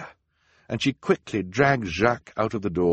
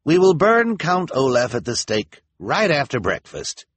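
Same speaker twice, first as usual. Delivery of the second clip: higher, loud public announcement; elder's announcement; 8 semitones higher, 7 dB louder than usual. A narrator's reading. Elder announcing the burning to townspeople, loud and raised. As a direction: proclaim it to the whole town. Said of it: "he continued".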